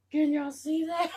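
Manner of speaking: high, loud disbelieving cry; squealing amazement; excited shriek. Real, not acted. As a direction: shriek it to the viewers. Shocked at the doll.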